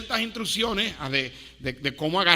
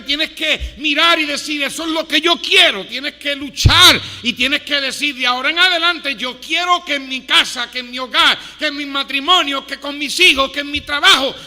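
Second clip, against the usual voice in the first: full-force preaching; 3 semitones higher, 9 dB louder than usual. Acted.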